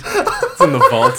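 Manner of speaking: high laughing line, slowed. Real, not acted. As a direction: laugh through it slowly. Laughing joke.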